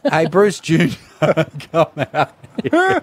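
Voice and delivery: mock dad calling out to Bruce Junior, laughing. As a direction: call your daughter in, cracking up. Mock dad calls daughter Bruce.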